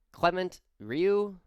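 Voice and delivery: name said slowly in a French accent, high and loud; a bad French accent, stretched out; a self-mocking French accent on a name. Shown as a demonstration. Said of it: "I can't do a French accent".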